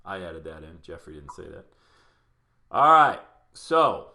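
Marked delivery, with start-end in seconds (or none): 2.7-4.1 loud call. Real, not acted. Host rallying live audience.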